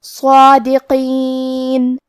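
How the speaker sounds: صادقین with a thick, heavy saad and long alif; صادقین with tafkheem on saad. Shown as a demonstration. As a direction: recite صادقین slowly, saad full and heavy. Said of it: "صاد کو ... بھاری آواز".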